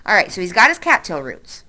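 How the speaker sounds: ordinary delivery, nothing marked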